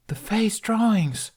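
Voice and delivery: high, slow amazed exclamation; boy spotting glowing runes, amazed; gasped. Acted. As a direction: breathe it out in wonder. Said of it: "Jamie gasped".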